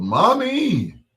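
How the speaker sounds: put-on high loud child's cry; yelled. Anthony Junior sees a bear, high cry. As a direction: call out high like a scared kid. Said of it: "he yelled".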